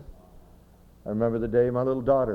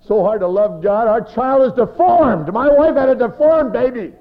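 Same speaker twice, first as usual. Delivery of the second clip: loud high mock wail; hammed-up anguished outcry; crying, imitated; 13 semitones higher, 12 dB louder than usual. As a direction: wail it out like a distraught father. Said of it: "Guy came to me and he's crying".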